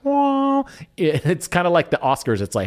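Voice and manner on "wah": high, held harmonica-like "wah"; imitated harmonica toot. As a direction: mimic a harmonica note, pitched way up. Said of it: "he blows the harmonica very softly".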